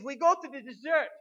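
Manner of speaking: high, very loud mock German accent. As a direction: belt it out in a put-on German accent. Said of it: "he said with his great accent".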